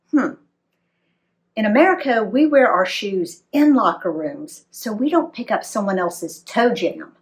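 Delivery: usual speaking voice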